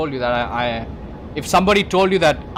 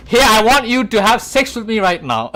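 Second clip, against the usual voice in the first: mock seductive demand; 7 semitones higher, 7 dB louder than usual. Retold lover's bold demand.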